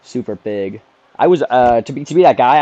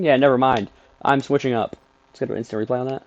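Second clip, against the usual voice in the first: normal talking voice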